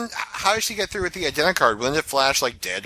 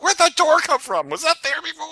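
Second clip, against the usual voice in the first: high, bewildered question; puzzled disbelief at a new door; confused surprise; 13 semitones higher, 3 dB louder than usual. Retold or imitated. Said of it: "She's like".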